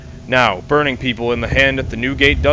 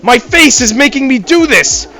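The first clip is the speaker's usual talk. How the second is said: much higher, much louder frantic yell; 9 semitones higher, 7 dB louder than usual. Acted. Guy punching a banana on his head, frantic much higher yell. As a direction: blurt it out in a panic.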